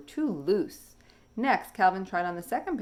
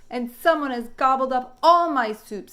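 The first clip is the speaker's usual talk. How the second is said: put-on higher, louder little baby voice; baby dinosaur; 7 semitones higher, 9 dB louder than usual. Baby finding his soup all gone, upset. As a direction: wail it like an upset little one. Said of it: "said the baby".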